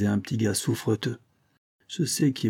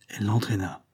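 plain reading voice

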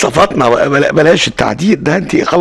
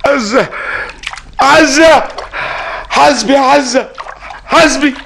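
desperate calling; 9 semitones higher, slower than usual. Acted. Drowning man calling out to Azza to watch out, desperate shout. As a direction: call out to her across the waves.

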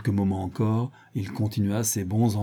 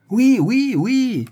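very high, loud yeses; exasperated outburst; 12 semitones higher, 6 dB louder, much slower than usual. Acted. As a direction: explode with impatience on each yes. Vorski exploding under the questioning. (oui criés, exaspérés)

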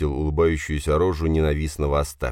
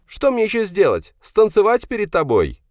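high, loud, hurt sarcasm; exasperated retort; 5 dB louder than usual. Acted. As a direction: challenge father in a very high voice. Son argues back in quarrel. (возмущённо, громко и высоко)

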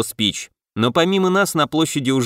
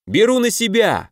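sharp battle cry, raised pitch; shouted command; 8 semitones higher than usual. A narrator's reading. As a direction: call it out taking charge. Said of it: "крикнул я".

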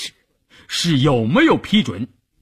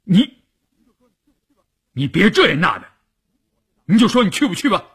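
raised pitch, impatient demand; stern, glowering; 6 semitones higher, 3 dB louder, faster than usual. A narrator's reading. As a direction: cut through the excuses angrily, ending fast. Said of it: "立刻虎着脸". (虎着脸厉声逼问)

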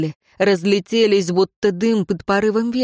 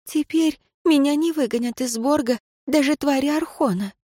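breathless high woman; 7 semitones higher than usual. A narrator's reading. Leira continues breathless.

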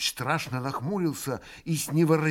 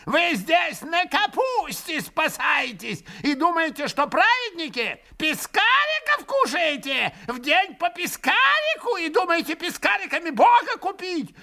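put-on high shrill jeering old man; shrieking old man; 14 semitones higher than usual. A narrator's reading. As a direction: jeer and shriek at the monks. Old man keeps shrieking at monks.

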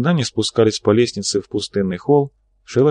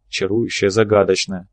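ordinary delivery, nothing marked

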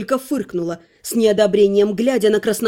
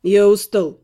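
curt; slower than usual. Acted. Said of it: "кратко ответил Сол".

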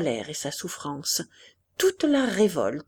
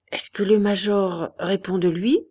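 usual speaking voice

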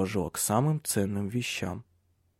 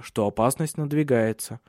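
usual speaking voice

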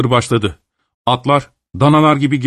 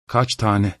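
softer, dreading the answer; quiet low dread; dreading question; 4 semitones lower, 6 dB quieter than usual. A narrator's reading. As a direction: ask as if you already know it's bad. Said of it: "diye sordu".

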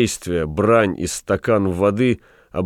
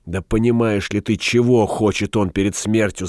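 ordinary delivery

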